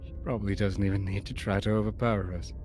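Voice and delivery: gloomy hero voice. Acted. Hero voice, low and pessimistic.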